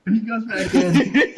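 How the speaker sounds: slow, laughing through it. Real, not acted. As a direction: crack up mid-sentence.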